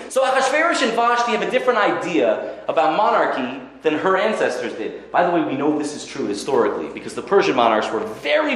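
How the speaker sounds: ordinary talk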